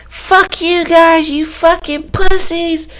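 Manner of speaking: put-on very high, slow, drawn-out girly taunt; mocking girl voice. Girl yelling from a car window at the guys, high mocking voice. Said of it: "this girl lowers the window and goes".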